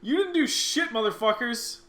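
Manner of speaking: high, loud teasing shout; mock yell. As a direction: yell it at them jokingly. Mock yelling at the game characters.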